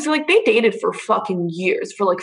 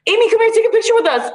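quick, high-pitched mimicry of a casual call-over; imitated cheery call; 11 semitones higher, faster than usual. Boyfriend calls his girlfriend by the ex's name, imitated cheery call. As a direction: call out obliviously, pitched well up and hurried.

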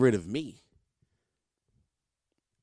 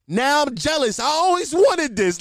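high, hammed-up jealousy; put-on wide-eyed admiration; feigned sincere envy; 10 semitones higher, 4 dB louder than usual. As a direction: gush with fake envy to fool a kid. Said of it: "They think that you being sincere".

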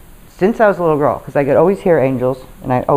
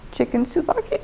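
quiet, higher questioning voice; tentative suggestion; 8 semitones higher, 9 dB quieter than usual. Retold or imitated. Companion suggesting a dish across the diner table, tentative. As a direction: float it softly, rising at the end.

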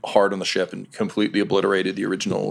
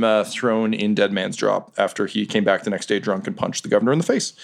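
usual speaking voice

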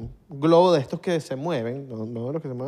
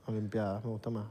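ordinary talk, nothing marked

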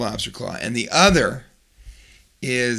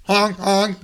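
mock car horn, higher; honking car horn; 7 semitones higher than usual. Jeep honking at the teacher, mock horn. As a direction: honk like a Jeep.

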